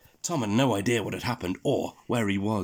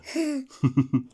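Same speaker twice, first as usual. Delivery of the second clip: high, far giggling burst; high giggle; 4 dB louder than usual. Acted. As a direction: giggle helplessly at the joke. Tom bursts out laughing.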